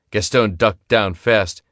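plain reading voice

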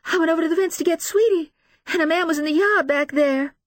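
high, loud, halting confession; distraught mother recounting; sobbing. A narrator's reading. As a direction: stammer it out through sobs. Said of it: "Missus Paulson sobs".

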